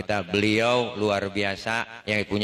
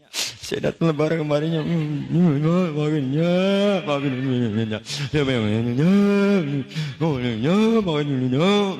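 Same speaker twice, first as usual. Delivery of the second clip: louder, wailing sobbed pleas, words smeared by crying; hammed-up weeping as he begs forgiveness; mock sobbing apology; 5 semitones higher, 5 dB louder, slower than usual. Son begging his mother's forgiveness at Lebaran, comic wailing sobs. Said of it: "ngomong sambil nangis kagak jelas ngomong".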